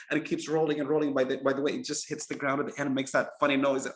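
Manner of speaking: normal talking voice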